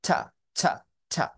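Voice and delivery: ছা as a voiceless puff of air; breathy aspirated t. Shown as a demonstration. Teacher demonstrating the released t to students. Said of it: "release টি".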